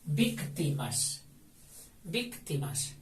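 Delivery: Víctimas with the weight on VÍC, drawn out; stressed first syllable. Shown as a demonstration. Teacher showing the class the stressed syllable. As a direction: lean on VÍC in Víctimas, slowly. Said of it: "Esdrújula, acento en la primera I".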